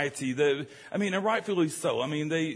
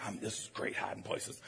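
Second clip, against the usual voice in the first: low, barely voiced whisper; hushed hiding kid; 8 semitones lower, 13 dB quieter than usual. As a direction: whisper secretly from a hiding spot. Child hiding in clothes racks.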